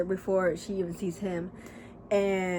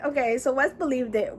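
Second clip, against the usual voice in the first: normal talking voice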